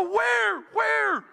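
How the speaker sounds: high, urgent calling out; urgent shout. Retold or imitated. Lifeguard to yelling bystanders, urgent high shout. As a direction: shout the question, pitched right up. Lifeguard answering a drowning alarm.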